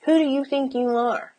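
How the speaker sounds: hushed, put-on reproach; scolding voice. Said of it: "B S shit I grew up with".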